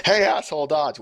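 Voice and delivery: high, loud play-acted shout; hammed-up, irritated holler; mock warning yell. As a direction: yell at a comrade to get out of the way. Acts out screaming over the Vox.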